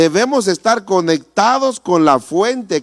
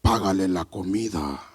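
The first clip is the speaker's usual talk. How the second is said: voice of God; 9 semitones lower, 7 dB quieter than usual. Retold or imitated. Preacher imitates God telling him to pay for food, deep solemn voice. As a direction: intone it softer and deep, as God would. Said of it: "así yo escuché la voz de Dios".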